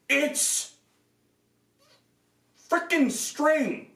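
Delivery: high, slow, disgusted drag on each word; frustrated. Real, not acted. Reviewer complaining the armor is held by string, high and disgusted. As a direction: spit out how cheap it is. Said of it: "this is really frustrating".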